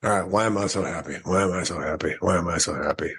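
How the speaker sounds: low, quick, unconvinced repeats; half-hearted recitation. Retold or imitated. Driving away trying her exercise, half-hearted. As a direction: mumble through it low, not meaning it. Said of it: "I say it three times, kinda like that".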